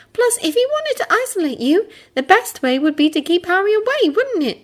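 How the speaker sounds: put-on high girl voice, reasoning; Hermione voice. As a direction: lay out the logic.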